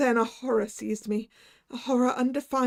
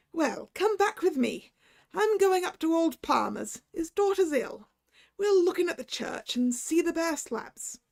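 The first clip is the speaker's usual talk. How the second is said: higher, hearty Irish doctor; 6 semitones higher than usual. Acted. Irish doctor coaxing the narrator back, hearty Irish. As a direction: coax him along, Irish lilt.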